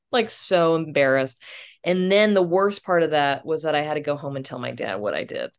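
ordinary delivery

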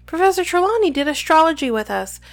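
quick, high, eager Parvati; excited. A narrator's reading. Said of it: "said Parvati excitedly".

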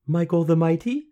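put-on high questioning girl voice; tentative suggestion. A narrator's reading. Girl guessing the name, tentative. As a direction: venture a guess, lifting high.

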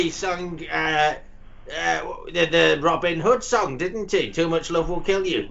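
ordinary talk, nothing marked

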